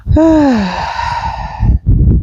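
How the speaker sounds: long, drawn-out exasperated sigh. Real, not acted. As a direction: let out a long breath. Exasperated by the dog.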